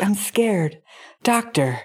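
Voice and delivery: breathy whispered damsel parody; put-on scared, seductive whisper; mock porno dialogue. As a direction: whisper it like a hammed-up porno movie line. Mocking porno movie dialogue from book.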